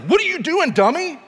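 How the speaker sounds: very high, loud exasperated scolding; squawked parental scolding, played for laughs; mock angry outburst. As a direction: explode at the kid in hammed-up disbelief. Parent's scared anger at child, retold.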